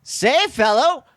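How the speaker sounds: put-on high, slow old-timey pitchman; mid-Atlantic accent. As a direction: sell a hotel like a 1920s radio ad. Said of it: "so that voice to me is like".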